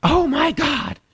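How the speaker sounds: high slow shrill mock squeal; mocking the neighbor girl. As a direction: parody an excited girl screaming. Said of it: "So she goes, she goes".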